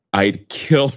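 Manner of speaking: normal talking voice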